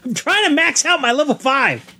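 high-pitched mock whine; mock protest. Host excusing himself to his scolding co-host, high mock protest. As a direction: plead your case in a squeaky high voice.

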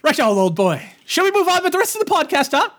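high, hammed-up British gent; mock British host. Nudging co-host onward, mock British. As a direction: put on a silly British accent.

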